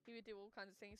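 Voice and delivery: ordinary talk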